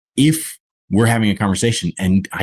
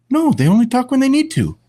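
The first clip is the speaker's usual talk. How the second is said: put-on much higher, emphatic small boy on no; indignant little boy. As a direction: insist like a stubborn four-year-old. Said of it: "This little British boy".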